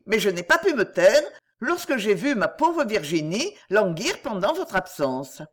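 ordinary delivery, nothing marked

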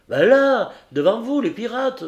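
high, loud, urgent cry; alarmed pointing out. Acted. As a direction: warn the captain of the danger right there. Héros affolé montre les pirates. (cri affolé, aigu et fort)

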